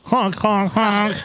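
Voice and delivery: high honking goose voice. Pretend. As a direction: honk like a goose. Playing the goose.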